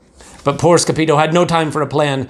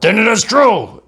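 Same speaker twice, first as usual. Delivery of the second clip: decree; 7 semitones higher, 3 dB louder than usual. A narrator's reading.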